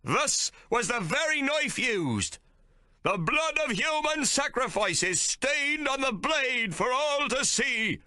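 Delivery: high, slow, preaching captain voice; a zealot captain condemning Hawke; thundering captain. A narrator's reading. Captain shows the knife.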